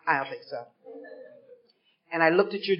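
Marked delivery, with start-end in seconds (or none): 0.1-0.6 dismissive aside. Retold or imitated. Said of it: "Really, twelve and twelve?".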